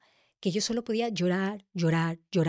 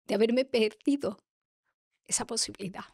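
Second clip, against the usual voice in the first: emocionada. Real, not acted. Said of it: "Por eso me emociona".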